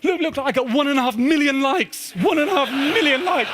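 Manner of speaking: very high, urgent calling of dad; excited teen. Retold or imitated. Son running upstairs to tell his father big news, very high and excited. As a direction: burst in breathless with news, pitch way up.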